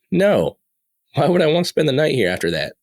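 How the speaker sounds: high, incredulous retold refusal; emphatic refusal. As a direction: jump up in pitch as if the question is absurd. Retold refusal to stay the night.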